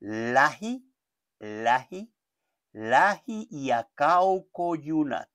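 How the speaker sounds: "lahi" repeated slowly, "acaucoyunat" drawn out; slow Finnish drill. Shown as a demonstration. Teacher asks the student to repeat, slow and clear. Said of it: "inténtalo ahí conmigo".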